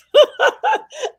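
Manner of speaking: high, bright burst of laughter; real laugh. Real, not acted. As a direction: laugh out loud at the memory. Laughing at her own story.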